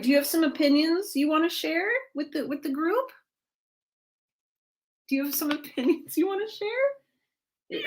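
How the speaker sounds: high, cooing question to the cat. Real, not acted. Streamer teasing her cat about its opinions in pet baby talk.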